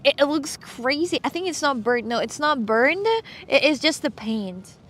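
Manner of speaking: ordinary delivery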